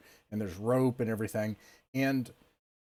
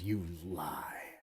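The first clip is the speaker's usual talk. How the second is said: menacing low accusation; 4 semitones lower, 9 dB quieter than usual. Acted. Elder jabbing finger into chest.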